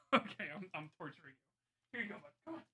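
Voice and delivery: ordinary talk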